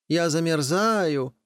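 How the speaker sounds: high, pleading rooster call; shrill-voiced rooster begging to come in; rooster's cry. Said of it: "кричит петух".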